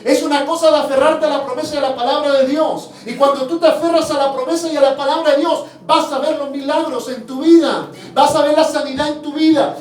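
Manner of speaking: preaching. Acted. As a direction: preach with conviction, hammering each promise. Preaching to the congregation. (predicación con fervor, más fuerte y rítmica)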